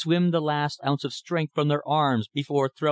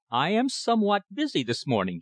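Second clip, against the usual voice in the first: shade of command; 7 semitones higher than usual. A narrator's reading. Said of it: "with a shade of command in his voice".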